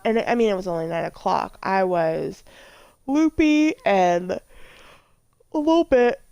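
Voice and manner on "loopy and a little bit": yawning while talking. Real, not acted. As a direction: talk through a yawn. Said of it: "excuse me, I'm yawning".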